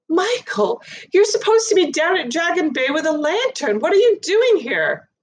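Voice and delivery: high, urgent, accusing mother; mother scolding her son in shock; alarmed mother. Acted.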